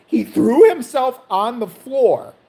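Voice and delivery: ordinary talk, nothing marked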